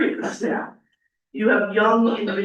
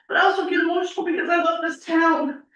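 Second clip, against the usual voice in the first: getting emotional; 6 semitones higher than usual. Real, not acted. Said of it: "I get emotional".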